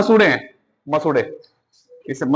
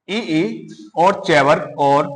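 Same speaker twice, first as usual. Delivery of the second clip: slow dictation; much slower than usual. Shown as a demonstration. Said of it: "teacher lists the letter group".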